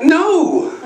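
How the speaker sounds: high, emphatic comic no; mock refusal. Dad to daughter about the doll, mock refusal.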